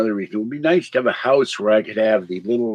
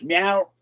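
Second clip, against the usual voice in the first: a short playful meow that rises at the end; mock meow; 6 semitones higher, 4 dB quieter than usual. Owner voicing his cat being sued, with a playful rising meow. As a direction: answer for the cat with a cute little meow.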